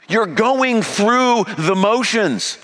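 high, slow, preached accusation; ringing slow preached rebuke; loud accusation. Acted. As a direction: drive each word home from the pulpit. Jesus's accusation, voiced loud.

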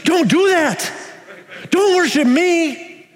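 high-pitched, urgent angel's rebuke; alarmed angel refusing worship; emphatic forbidding. Acted. Angel stops John worshipping him.

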